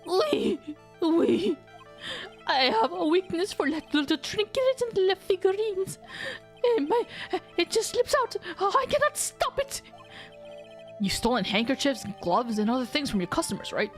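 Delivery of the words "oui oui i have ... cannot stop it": high, sheepish French chef voice; French accent. Acted. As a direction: confess coyly in French accent. French chef confesses weakness.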